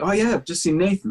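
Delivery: high, quick, eager imitation of a friend; lightly put-on excited friend; mock excited hype. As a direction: rattle it off like a keen mate. Retelling friend's repeated hype.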